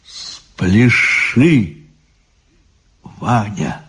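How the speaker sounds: gentle, hushed coaxing; soft and tender. A narrator's reading. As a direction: coax softly and affectionately. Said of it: "тихо и ласково". (тихо и ласково)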